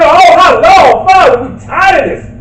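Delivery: high, loud plea to the Father; crying out. Acted. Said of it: "We continue crying".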